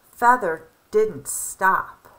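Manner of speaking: ordinary delivery, nothing marked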